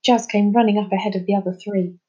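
ordinary delivery, nothing marked

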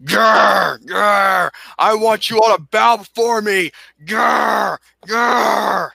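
growling tyrant demanding worship; dark lord. Shown as a demonstration. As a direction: snarl like an angry overlord. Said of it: "I'm surprised my temper doesn't intimidate people".

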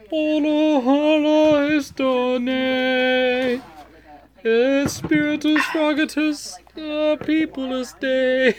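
high, slow mock Latin chant; mock incantation. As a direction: intone fake Latin like a priest.

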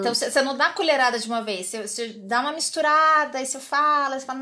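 usual speaking voice

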